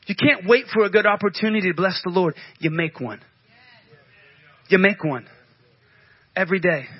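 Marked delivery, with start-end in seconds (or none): 2.6-3.2 much lower and softer, almost to himself; dropped low and soft. Acted. Preacher repeating the point to the congregation, low and soft. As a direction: let it sink in quietly.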